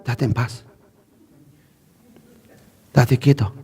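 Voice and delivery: deep, soft stern parent scolding; a father telling his child to settle down, low and hushed. Retold or imitated. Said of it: "le dicen con una voz bien enérgica así". (padre regañando con voz grave y baja)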